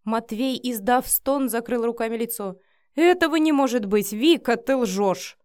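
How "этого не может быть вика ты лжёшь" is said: loud, higher, disbelieving protest; indignant outburst; appalled denial. A narrator's reading. Denies the shaming story.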